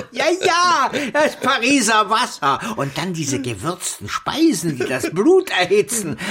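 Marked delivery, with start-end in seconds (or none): 0.1-2.6 amused. Acted. Homais laughing about Paris.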